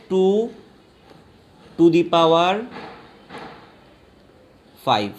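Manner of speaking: "ଟୁ to the power five" in three separate chunks; pronunciation demo. Shown as a demonstration. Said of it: "এইটাকে উচ্চারণ করবে".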